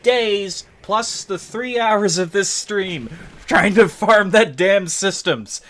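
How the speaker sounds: shouting high and loud, a little slow; exasperated rant. Real, not acted. Streamer venting to chat about the long grind. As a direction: drag out the complaint at full volume.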